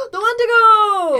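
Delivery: gasping, high, loud excitement; excited gasp. Real, not acted. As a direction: gasp and shout the name with delight. Excited outburst at name.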